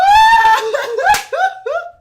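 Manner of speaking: very high, loud screaming laugh; piercing shriek. Real, not acted. Reacting to a record solve, piercing shriek. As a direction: shriek helplessly in long bursts.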